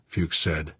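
ordinary delivery, nothing marked

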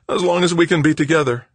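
high, loud, tearful pleading; cling to her with your words. A narrator's reading. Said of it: "Greg sobbed".

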